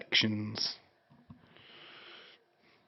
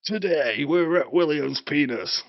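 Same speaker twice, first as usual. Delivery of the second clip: high, loud mock TV host intro; mock presenter; 5 semitones higher, 5 dB louder than usual. Joking intro of a silly food challenge, mock presenter. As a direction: announce it like a food show host.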